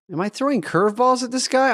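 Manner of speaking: high, quick, incredulous aside; exasperated disbelief. Retold or imitated. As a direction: pitch shoots up in comic exasperation. Exasperated aside about slow order-taker.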